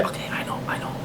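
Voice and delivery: quick whispered aside; hushed concession. Real, not acted. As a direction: whisper it under your breath, conceding. Aside to the imagined objector.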